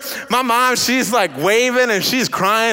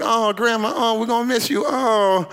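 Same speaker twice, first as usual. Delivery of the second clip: mock-sad children. Children sadly part from grandma.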